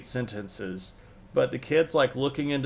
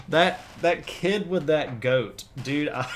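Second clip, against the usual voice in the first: amused; 5 semitones higher, 5 dB louder, slower than usual. Real, not acted. Cracking up over kid and goat.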